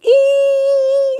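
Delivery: loud, very high sustained "eee"; high squeal. Acted. Streamer keeps going with a long, loud high squeal. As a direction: squeal one long piercing note. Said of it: "I'm gonna keep going".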